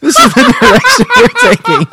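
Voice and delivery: talking through laughter; cracking up mid-sentence; laughing. Real, not acted. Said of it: "we broke [name]".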